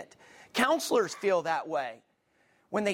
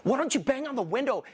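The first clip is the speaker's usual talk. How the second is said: higher-pitched exasperated urging; mock frustrated yell; 6 semitones higher than usual. Viewer urging on a trapped movie kid, mock frustrated yelling. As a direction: yell at the screen, not at full volume. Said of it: "I'm yelling at him".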